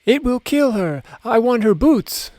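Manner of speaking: high, loud delighted shriek; gleeful shriek. A narrator's reading. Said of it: "shrieked in delight".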